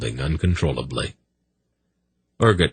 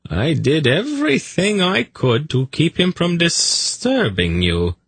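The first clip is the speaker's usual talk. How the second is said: high-pitched coaxing excuse; placating; 11 semitones higher than usual. A narrator's reading. Zadi making excuses to his snake, placating. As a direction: plead with a sulky pet.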